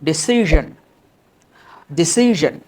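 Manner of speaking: "ডিসিশন" as di-SI-zhun; firm model of "ডিসিশন" with buzzed zh; correct zh pronunciation. Shown as a demonstration. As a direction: end "ডিসিশন" in -zhun, not -shon. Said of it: "উচ্চারণটা কী দিয়েছে".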